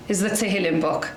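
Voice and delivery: ordinary talk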